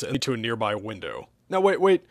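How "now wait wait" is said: high, loud plea to halt; urgent call, last words stretched. Acted. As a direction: call out to stop him. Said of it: "Hugh called out".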